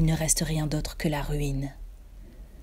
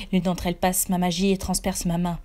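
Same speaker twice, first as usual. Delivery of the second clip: ordinary delivery, nothing marked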